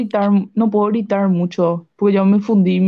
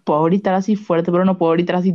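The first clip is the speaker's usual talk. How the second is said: ordinary delivery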